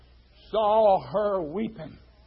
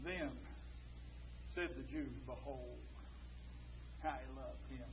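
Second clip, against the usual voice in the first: very soft, low and confiding; hushed preaching; 8 semitones lower, 22 dB quieter than usual. Real, not acted. Preacher explains the one cure for grief, hushed preaching. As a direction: draw the congregation in with a low hush.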